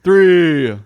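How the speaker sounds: loud, high quiz host voice; game show announcer. Acted. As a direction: rattle off the rules like a quiz host. Counting answers as host.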